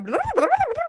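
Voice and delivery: high-pitched imitation of an angry rant; hammed-up upset blathering; mad ranting. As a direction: gabble out mock furious babble. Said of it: "when I get mad and upset".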